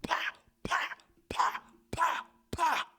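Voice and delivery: soft breathy "pa pa" bursts; imitated gunfire kept quiet; whispered mock shots. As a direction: mimic rapid hits under the breath. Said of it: "it was like".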